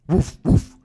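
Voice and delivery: dog barking. Acted. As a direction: imitate a big dog's bark. Big dog barking in story.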